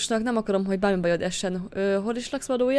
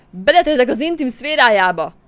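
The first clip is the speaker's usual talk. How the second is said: loud, higher indignant cry; outraged shout; 4 semitones higher, 10 dB louder than usual. Acted. She shouts at the man grabbing her, outraged. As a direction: yell it in indignation.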